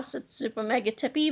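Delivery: usual speaking voice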